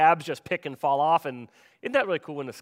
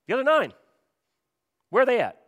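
higher, louder, with long pauses; emphatic questioning; 8 semitones higher, 6 dB louder, faster than usual. Acted. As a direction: demand to know where the missing ones are. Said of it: "similar to the way the Greek has it".

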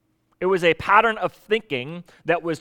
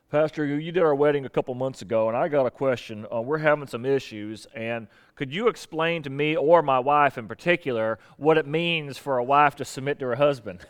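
put-on Southern accent; 4 semitones lower than usual. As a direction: imitate a husband phoning the pastor in a Southern accent. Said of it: "I use a Southern accent".

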